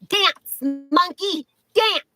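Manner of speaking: high, staccato mock order; mocking command. Woman pretending to order ID checker around, high mocking command. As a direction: taunt it in high, separate words.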